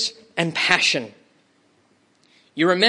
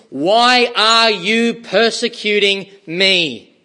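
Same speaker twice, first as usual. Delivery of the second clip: slow confronting question; 3 semitones higher, 4 dB louder, slower than usual. Acted. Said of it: "asks Saul a simple and confronting question".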